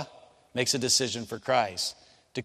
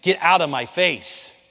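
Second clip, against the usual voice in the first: slow, louder get out of my face; loud firm command; 4 semitones higher, 6 dB louder, slower than usual. Retold or imitated. Pastor ordering the screaming man away, slow and loud. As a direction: plant your feet and order him off slowly. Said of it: "I said".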